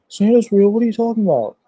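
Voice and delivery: high-pitched mock surprise, voice shooting up; played-off disbelief. Kid playing it off to mom about Santa, high mock disbelief. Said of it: "I kinda played it off like".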